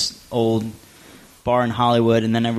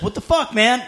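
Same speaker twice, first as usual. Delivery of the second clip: mock aggressive bark, pitched way up; aggro bouncer; 8 semitones higher than usual. Bouncer squaring up to George, imitated aggressive bark. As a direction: square up like you're ready to fight. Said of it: "aggro, about to beat him up".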